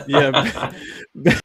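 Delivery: ordinary talk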